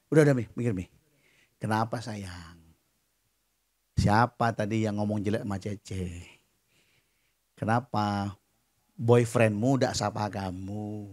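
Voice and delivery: low, soft coaxing voice; soothing father. Retold or imitated. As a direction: gently ask a sulking child what is wrong. Father gently soothing sulking daughter. (membujuk anak dengan lembut)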